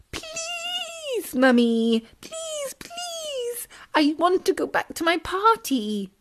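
put-on very high crying child begging; pitiful pleading. Tadpole begging his mother to go back, crying. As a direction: wail the pleases. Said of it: "pitiful little pleas".

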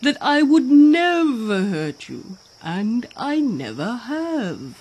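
put-on hippo voice, high then slowing; earnest hippo. A narrator's reading. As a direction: vow solemnly as a big story animal. Hippo reassures the fish.

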